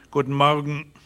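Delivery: lightly voiced, curt reply at normal pitch; cool Magnus. A narrator's reading. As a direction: give the greeting back without warmth. Magnus answers coolly.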